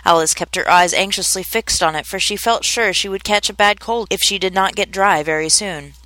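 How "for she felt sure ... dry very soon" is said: usual speaking voice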